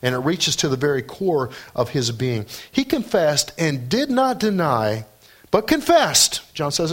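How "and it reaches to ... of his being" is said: usual speaking voice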